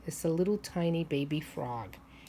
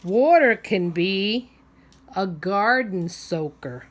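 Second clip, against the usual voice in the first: louder, slow storybook announce; reading aloud to children; 6 dB louder, slower than usual. Acted. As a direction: read it out proudly to the class. Reads book line, louder.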